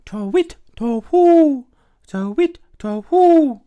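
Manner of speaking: high, loud rising and falling hoots; piercing twit-twoo call; hooting owl. Acted. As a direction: hoot like an owl in the night. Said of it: "went the owl".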